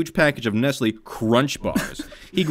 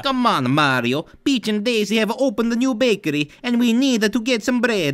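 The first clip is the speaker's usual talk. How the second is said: high, nagging Luigi; 11 semitones higher than usual. Acted. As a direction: urge your brother along.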